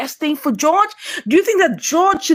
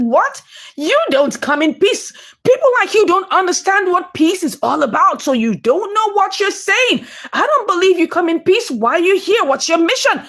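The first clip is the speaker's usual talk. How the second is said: higher-pitched acted challenge; confrontational; 6 semitones higher than usual. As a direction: challenge an unwelcome visitor, pitch raised. Acted quarrel between two mothers.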